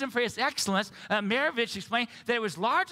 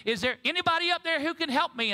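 calling up, pitched higher and urgent; crying out; 8 semitones higher than usual. Retold or imitated. Man on a cliff crying out to heaven, high calling voice. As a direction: plead upward like a man hanging off a cliff. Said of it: "he cried out to the heavens".

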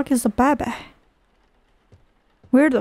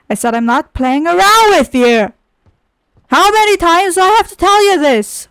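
raised voice, climbing in exasperation; louder, insistent telling-off; angry scolding; 6 semitones higher, 6 dB louder than usual. Pretend. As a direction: scold like you've had enough. Repeats the warning, scolding the game.

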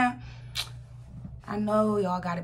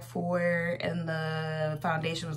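ordinary delivery

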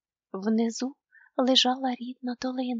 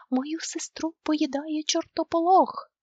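panicked plea; 6 semitones higher, 4 dB louder, slower than usual. A narrator's reading. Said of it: "закричав він".

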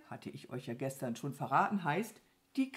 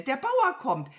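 loud, high crow warning; alarmed call; 9 semitones higher, 8 dB louder than usual. A narrator's reading. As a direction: call out the danger loudly. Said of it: "rief die Krähe". (laut und hoch warnen)

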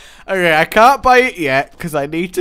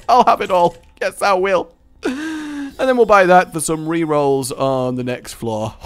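ordinary delivery

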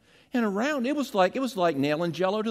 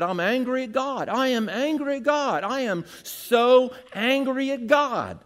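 mock angry man, a touch higher; angry counselee; 6 semitones higher than usual. Pastor imitates the angry man in counseling, mild mock anger. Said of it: "He said".

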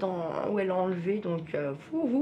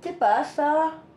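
surprised; 9 semitones higher, 10 dB louder, much slower than usual. Real, not acted. Surprised exclamation at object.

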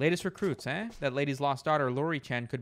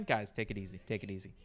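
calming chat; 7 semitones lower, 7 dB quieter, faster than usual. Real, not acted.

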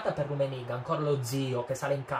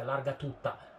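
usual speaking voice

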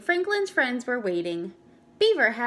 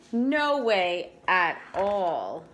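slow, low glum murmur; Franklin's gloom, said slow and low; 4 semitones lower, much slower than usual. A narrator's reading. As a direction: let it sink, slow and low. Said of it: "Franklin frowned".

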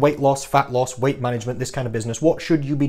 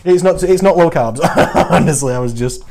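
louder, breaking into real laughter while talking; laughing; 6 dB louder than usual. Real, not acted. Coach admitting the carbs line was a joke, laughing through it. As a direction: crack up at your own joke.

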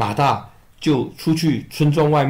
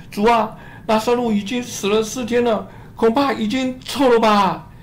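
higher pleading voice for Martha; higher-voiced sister, gently reproachful; grieving Martha; 9 semitones higher than usual.

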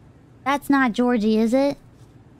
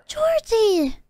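excited call; 8 semitones higher than usual. Real, not acted. Greeting beloved character excitedly.

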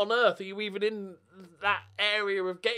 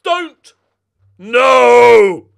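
high, loud desperate cry; real pleading scream; anguished shout; 7 semitones higher, 21 dB louder than usual. Real, not acted. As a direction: yell it as the ball goes in. Opponent about to score late.

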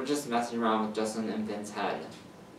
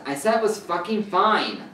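louder, higher, exasperated insistence; angry outburst; 8 semitones higher, 7 dB louder than usual. A narrator's reading. Justin cutting off Vinny's apology, angry outburst. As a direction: snap it out, fed up with being asked. Justin snaps during quarrel.

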